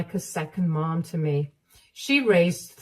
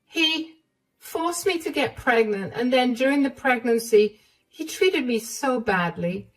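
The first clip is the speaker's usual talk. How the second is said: higher, hurt recounting; hurt young wife; 6 semitones higher than usual. Acted. Emma recounting David's cruelty to his mother, higher and hurt. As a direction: tell the painful story.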